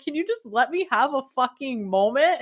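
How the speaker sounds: mock-exasperated whine; exasperated plea. Snapping at her inner voice.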